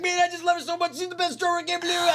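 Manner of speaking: overflowing with tearful love. Retold or imitated. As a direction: rock star gushing about the drummer, high and weepy. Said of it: "He's like".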